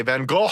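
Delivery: Van Gogh pushed from the throat; throat-straining pronunciation. Shown as a demonstration. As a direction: Gogh overdone as a throaty "koh". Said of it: "I don't wanna throw out my throat".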